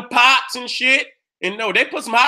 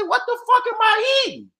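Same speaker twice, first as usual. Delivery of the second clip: high, squeaky what the fuck; shocked disgust, retold; 11 semitones higher than usual. Retelling biting the pizza, high shocked squeal. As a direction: squeal it in horror at the taste. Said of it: "I say".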